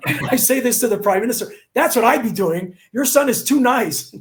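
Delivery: amused retelling with laughter in the voice; laughing. Real, not acted. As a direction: chuckle through the words.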